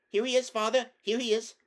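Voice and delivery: high, loud eager call; a boy calls to his father; excited cry. A narrator's reading. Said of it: "cried Corin".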